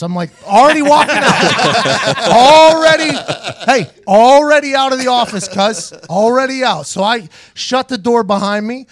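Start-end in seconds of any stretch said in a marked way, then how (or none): 0.4-6.9 laughing gloat. Retold or imitated. Gloating while leaving office.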